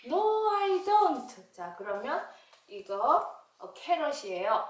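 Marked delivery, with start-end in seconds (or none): 0.1-1.5 very high, loud, drawn-out no; puppet child refusing. Pretend. Child puppet refusing vegetables, high and drawn out. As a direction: whine it out slowly like a stubborn kid.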